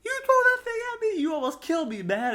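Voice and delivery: high squeaky outraged protest, fast and loud; mock outraged yelp. Friend retelling Jason's panicked complaint, high squeaky mock outrage. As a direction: squeal it like you nearly got speared.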